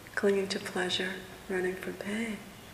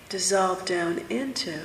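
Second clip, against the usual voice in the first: ordinary delivery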